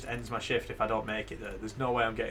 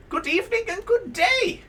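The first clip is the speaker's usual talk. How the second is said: very high, loud alien greeting; shrill alien passenger; 7 dB louder than usual. Acted. Alien passenger greeting the driver, shrill and loud. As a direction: squeal it out as a strange alien. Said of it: "His shrill notions are offensive to your ear".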